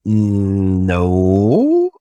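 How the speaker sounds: long hummed hesitation sliding up into a no; mock refusal. Refusing a vendor that wants full admin rights, mock refusal. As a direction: draw out a sceptical, joking no.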